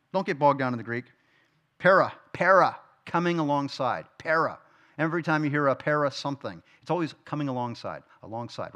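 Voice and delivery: ordinary talk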